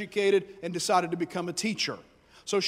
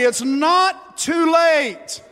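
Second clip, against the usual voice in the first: loud, slow, high shout of it's not too late; shouted call to the neighbor; 6 semitones higher, 7 dB louder, much slower than usual. Shown as a demonstration. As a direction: shout it out slowly to the person beside you. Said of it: "look at your neighbor, say".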